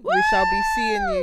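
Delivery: announcing outro. Real, not acted. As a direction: announce it to the audience.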